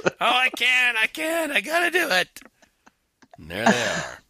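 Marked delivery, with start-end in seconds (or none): 0.0-2.4 high-pitched mock agonizing over temptation; mock desperation. Mocking a church that can't stay out of it, mock desperate. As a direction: whine it high, as if helplessly compelled. Mimicking someone unable to resist.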